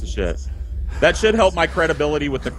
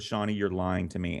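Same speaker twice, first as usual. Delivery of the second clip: tag stern quiet mom; 5 semitones lower, 9 dB quieter than usual. Acted. Acts out mom accusing.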